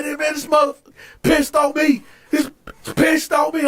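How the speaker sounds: high-pitched outraged explaining; indignant complaint. Retold or imitated. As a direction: re-enact hollering your grievance. Retold complaint to onlookers.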